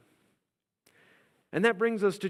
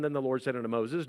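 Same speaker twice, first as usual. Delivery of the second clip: normal talking voice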